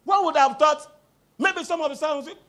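usual speaking voice

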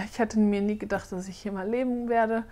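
ordinary delivery

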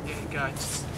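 soft, tense cue; barely voiced signal to move; hushed go signal. Real, not acted. Quiet instructions during the catch.

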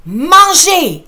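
slow, loud starting call; judge's shout. Acted. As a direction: launch the contest loudly. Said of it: "crie le juge". (cri de départ lent et fort)

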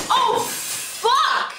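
high startled cry; fake shock. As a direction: pretend you just broke it. Fake accident, trolling.

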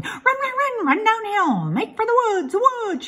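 high, loud goose holler; shouting. A narrator's reading. Goose hollers at Wilbur to run downhill, loud. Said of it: "she shouted to Wilbur".

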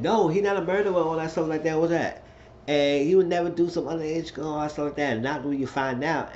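high, insistent mock denial. Mock-quoting the girl's denial.

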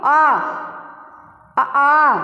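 a long open "आ", mouth and throat wide, breath pouring out. Shown as a demonstration. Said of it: "क्यों?".

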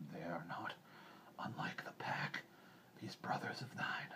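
hushed, breathy wolf voice; soft, whispered animal voice; composed old wolf. A narrator's reading. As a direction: half-whisper it as a calm wolf. Restarts as Akela, composed wolf.

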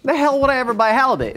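high-pitched mock disbelief; incredulous shopper. Voices shopper balking at fish price.

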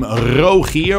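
"Rogier" with a throaty R at the start and a throaty G; "Rogier" with the R rolled in the back of the throat. Shown as a demonstration. Dutch speaker saying his own name, rolled back R. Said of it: "you wanna roll the R".

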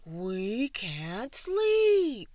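very slow, loud wail; shouting animals. A narrator's reading. Sleepless animals complaining to the bird, long loud shout. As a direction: shout it out, stretching every word. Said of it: "shouted the animals".

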